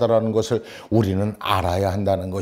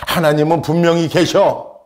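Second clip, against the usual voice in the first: put-on insistent little girl; 6 semitones higher than usual. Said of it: "이 여자아이가 하는 말이 이래요".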